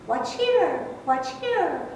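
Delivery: high sing-song birdcall, watch here then birdie birdie; high lilting bird imitation for kids; cardinal call imitation. Shown as a demonstration. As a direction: mimic a cardinal's whistle with your voice. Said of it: "it sounds like it's saying".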